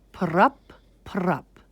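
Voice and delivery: two short, punchy pops; imitated popping noise. A narrator's reading. Narrator mimicking sticks pulled from iguana's ears, popping sound. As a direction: make a quick plucking sound, twice.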